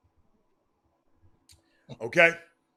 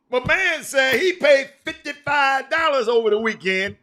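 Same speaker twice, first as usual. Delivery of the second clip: raised, higher voice in disbelief; outraged shout; 7 semitones higher, 6 dB louder than usual. Real, not acted. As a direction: call it out loud and indignant. Outraged over tournament fees.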